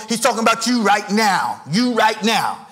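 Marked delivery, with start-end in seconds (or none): none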